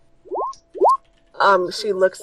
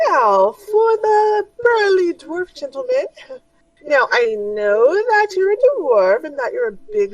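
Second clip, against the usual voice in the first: much higher, coaxing sales pitch; pushy high shopkeeper; 10 semitones higher than usual. Acted. Shopkeeper coaxing the burly dwarf, high and pushy. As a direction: wheedle the dwarf into a bow tie.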